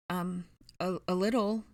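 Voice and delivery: hesitant, halting stammer. A narrator's reading. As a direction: stumble over the first word. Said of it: "Dennis stammered".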